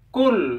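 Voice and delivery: "kul" with a front k, like kaf; wrong k sound. Shown as a demonstration. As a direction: say "kul" with a plain k. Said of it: "kalau dibaca kul, maka itu lafalnya kaf".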